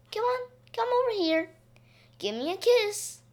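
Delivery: high, sing-song bird-like coaxing; coaxing parrot talk. A narrator's reading. Billy coaxing the parakeet home, high coaxing call. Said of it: "He said all the things Joey could say".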